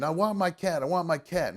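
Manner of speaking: insistent stranger. Retold or imitated. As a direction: repeat it like a stubborn stranger begging. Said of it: "starts pointing in my backyard saying".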